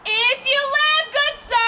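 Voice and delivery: high and loud, half sung; urgent call. Acted. Helena rousing Lysander, high sung loud plea. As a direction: call him awake, singing it out. Helena urging him awake.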